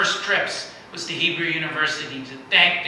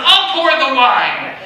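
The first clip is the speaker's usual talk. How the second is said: much higher, louder and slow; firm offer; 9 semitones higher, 10 dB louder, slower than usual. Retold or imitated. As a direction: declare it grandly, drawing it out. Ambassador insisting, retold.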